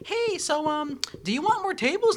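imitating the manager, high and light. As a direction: ape the manager in a high sing-song. Voicing the manager.

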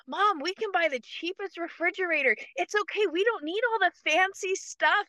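lightly voiced daughter, coaxing and kind; daughter reassuring. Daughter calming her crying mother, high soothing voice. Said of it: "my daughter's like".

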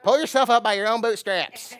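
hint of grandparents' advice; old-timer saying. Preacher imitating grandparents, lightly voiced old saying. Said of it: "My grandparents said it that way".

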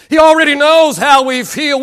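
full-voiced pulpit assertion; ringing sermon voice; preached repeat. Real, not acted. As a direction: raise it and drive it home. Preached repeat of the soft line.

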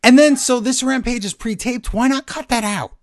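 high-pitched, incredulous complaint; exasperated rant. Real, not acted. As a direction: push the pitch up in frustrated disbelief. Ranting about pre-taped show not edited.

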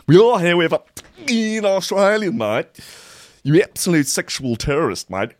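parodying the bar owners' statement as a rowdy Aussie; hammed-up Aussie. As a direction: blast it like a rowdy bloke.

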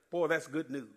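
normal talking voice

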